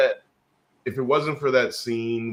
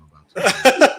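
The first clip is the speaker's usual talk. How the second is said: mock shout; 11 dB louder, slower than usual. Mock voice after workout line.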